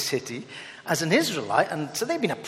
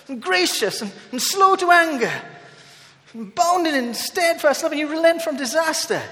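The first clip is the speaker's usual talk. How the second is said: higher, louder angry rant at God; angry Jonah; 10 semitones higher, 6 dB louder than usual. Retold or imitated. Jonah angrily quoting God's mercy back at him. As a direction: rail at God like a sulking prophet. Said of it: "he gets angry".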